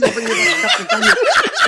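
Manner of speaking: laughing through quote. Retold or imitated. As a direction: cracks up retelling the toothless lady's offer. Repeating her offer, cracking up.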